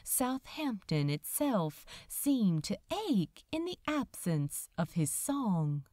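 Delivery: plain reading voice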